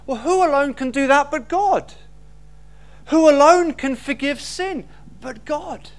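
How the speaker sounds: ordinary delivery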